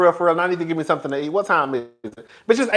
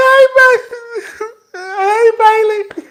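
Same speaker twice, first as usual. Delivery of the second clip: slow, high, sobbing hello; tearful greeting; 6 dB louder, much slower than usual. Real, not acted. Greeting her nieces while still crying. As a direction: stretch each word through the tears.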